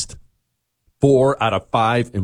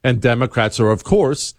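ordinary talk

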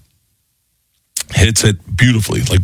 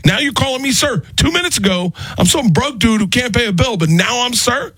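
much higher, mock-outraged Tracy voice; indignant Tracy; 13 semitones higher than usual. Tracy mocking the waitress calling him sir, high outrage. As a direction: hammer the word sir, high and incredulous. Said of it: "And he was like".

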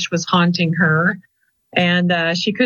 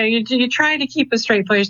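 ordinary talk

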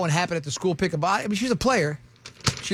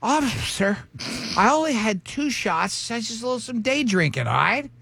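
put-on higher-pitched drunk mom act; 5 semitones higher than usual. Tipsy mom pleads with the officer at school pickup, mock drunk. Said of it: "She goes".

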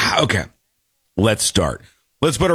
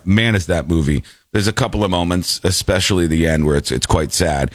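usual speaking voice

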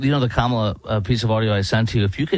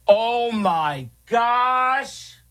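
very high, drawn-out imitation of disbelief; mock appalled wife; 12 semitones higher, much slower than usual. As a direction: stretch it out high like a stunned wife. Imitates wife's appalled reaction.

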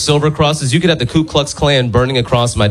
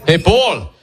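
higher-pitched show-off impression; bragging dad; 7 semitones higher, slower than usual. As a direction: imitate a dad showing off his house. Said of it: "loves bragging about his house".

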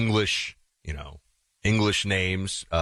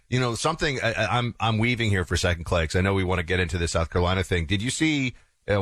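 ordinary talk

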